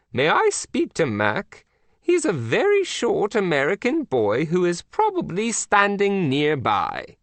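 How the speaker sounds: a prim queen breaking bad news; Queen of England. The Queen scolds agent Mac by phone, prim.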